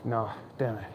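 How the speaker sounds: ordinary delivery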